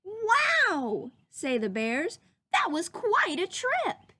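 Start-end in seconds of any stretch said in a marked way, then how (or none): 0.0-1.1 high, loud amazed wow; excited wow. Acted. Bears amazed after landing, excited wow. 2.5-4.1 high, loud and slow delight; excited bears. Acted. Bears stepping off their rocket, excited and loud. Bears exclaim after trip.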